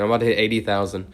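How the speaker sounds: ordinary talk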